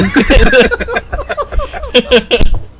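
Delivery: high, loud extended laughter; long loud laugh. Real, not acted. Laughing at his own Trump bit, long loud laugh. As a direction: laugh hard after the bit.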